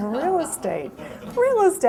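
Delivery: hushed disbelief jumping to a high squeal; mock-stunned, pitch leaping on the repeat; incredulous. Retold stunned reaction to friend's advice.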